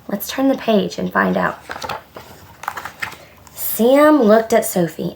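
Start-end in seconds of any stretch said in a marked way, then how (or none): none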